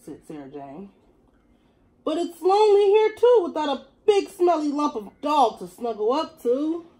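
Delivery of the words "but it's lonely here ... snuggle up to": high and louder cat voice; the cat softening up; cat voice admitting loneliness. Acted. Cat confessing she misses the dog too.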